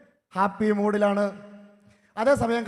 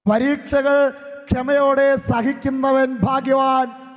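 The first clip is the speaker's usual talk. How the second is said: slow, solemn proclamation; preaching; 4 semitones higher, 7 dB louder, slower than usual. Acted. Priest proclaiming the verse to the congregation, slow and solemn. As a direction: proclaim the promise slowly.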